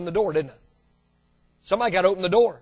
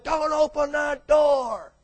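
slow, drawn-out mock warning, higher; 6 semitones higher, much slower than usual. People in the pew warning the pastor, slow and pleading. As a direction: drag out the warning like a fearful member. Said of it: "people saying".

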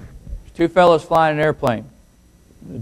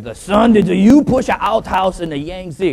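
mock Chinese accent; 5 semitones higher, 4 dB louder, slower than usual. Father pinning son against the wall, mock Chinese accent. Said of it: "this little Chinese boy".

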